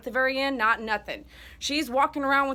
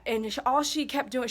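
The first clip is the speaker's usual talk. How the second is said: normal talking voice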